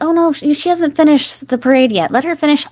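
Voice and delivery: imitating Brian. Voicing Brian reassuring the kid.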